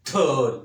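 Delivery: ढोल with a heavy, loud, breathy ढ; heavy sound. Shown as a demonstration. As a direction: boom out ढोल heavily. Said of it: "ऐसे भारी आवाज़". (भारी आवाज़ में ढोल)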